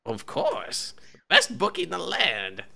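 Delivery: high-pitched bookie protest; halfling bookie butting in. Acted.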